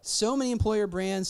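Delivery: usual speaking voice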